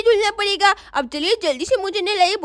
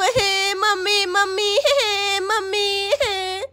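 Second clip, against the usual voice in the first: wailing for mom; much slower than usual. Acted. Girl wails for mother.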